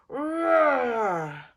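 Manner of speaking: loud playful roar. Acted. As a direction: roar like a big machine. Bulldozer's thunderous sound.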